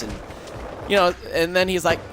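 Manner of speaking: ordinary talk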